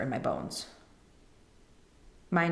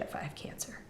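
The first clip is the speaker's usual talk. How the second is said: voiceless whisper of dread; barely breathed, scared inner thought; 13 dB quieter than usual. Retold or imitated. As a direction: whisper the fear to yourself. Panicked inner thought in the kitchen.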